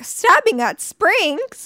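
high, slow, stunned question; shocked disbelief. Retold or imitated. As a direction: drag it out in disbelief. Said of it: "I was like".

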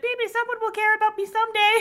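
high, forlorn wistful whimper; pitiful little voice. Retold or imitated. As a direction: sigh it like a neglected girl. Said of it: "just being like".

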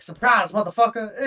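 loud gleeful shout at the enemy; gleeful yell. Retold or imitated. Gamer ambushing enemies in game chat, loud gleeful yell. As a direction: bellow it to ambush them.

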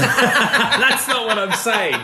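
high, slow laughing protest; laughing denial. Real, not acted. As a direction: deny it while laughing.

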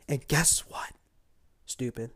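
hushed whisper; conspiratorial whisper; whispered aside. Real, not acted. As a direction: lean in and whisper it. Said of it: "whispered aside".

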